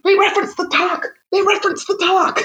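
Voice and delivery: high, thrilled repetition; can't believe it, tell them twice; excited telling friends about the talk. Retold or imitated. Retelling excited texts to friends.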